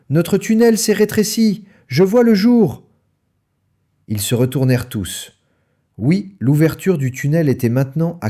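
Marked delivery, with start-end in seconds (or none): 0.1-2.8 a small cub blurting out in alarm; alarmed fox cub. A narrator's reading.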